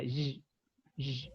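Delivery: "y" as a bare buzzed consonant; isolated consonant Y. Shown as a demonstration. Teacher isolating the sound for students, "y" as a buzzed consonant. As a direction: sound out "y" alone, like "zh". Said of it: "esa Y se considera consonante".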